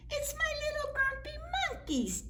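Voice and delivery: high, cooing mom voice; doting mom. Acted. Mom hugging her son, high coo. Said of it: "Jim's mom cried".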